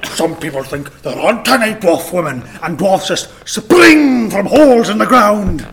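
slow build to a loud, high finish; mock dwarf quote. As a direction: declaim it like a hammed-up film dwarf. Acts out Gimli's film line.

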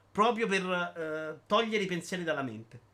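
ordinary delivery

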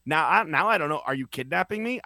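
ordinary talk, nothing marked

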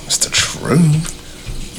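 hushed, breathy whisper of a blunt truth; whispered aside. Retold or imitated. Whispering to a friend about how coworkers see her. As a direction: lean in and whisper it. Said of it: "I'm like".

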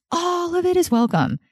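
"all of it is welcome" swept up very high; high, emphatic "all of it is welcome"; emphatic repeat. Real, not acted. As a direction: lift "all" far up in pitch for emphasis.

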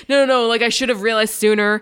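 dad refusing comfort, loud and guilt-ridden; upset dad protest. Retold or imitated. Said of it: "And he's like".

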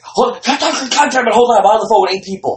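high, loud harried caller; turning away from phone. Retold or imitated. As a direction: snap aside while juggling phone calls. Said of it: "Tucker, it's like".

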